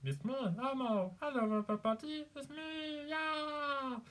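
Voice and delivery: high, cheery puppet monster voice; Elmo. Shown as a demonstration. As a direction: squeal a greeting in a high throaty voice. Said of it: "I can do Elmo".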